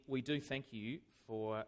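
ordinary delivery

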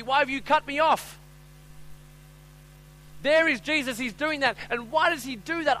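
high, loud impassioned sermon; full-force preaching. Real, not acted. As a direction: preach it out with urgency.

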